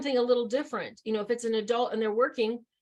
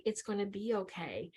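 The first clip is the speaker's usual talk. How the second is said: compassionate; 6 dB quieter than usual. Shown as a demonstration. Speaker showing helpers how to soothe, soft compassionate tone. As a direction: soothe them gently, in an even rhythm. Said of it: "I'm talking in compassion".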